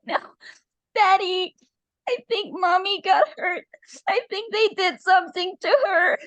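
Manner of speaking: put-on very high, tearful child voice; frightened child pleading through tears; crying little girl. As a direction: tell Daddy in distress, high-pitched. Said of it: "Ashley is still crying".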